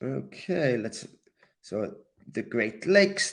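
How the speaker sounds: ordinary delivery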